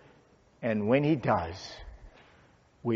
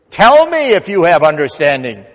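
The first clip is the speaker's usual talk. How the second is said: loud, raised divine demand; commanding challenge; 5 semitones higher, 14 dB louder than usual. Acted. Preacher voices God confronting Job, commanding challenge. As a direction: ring it out as a thundering demand.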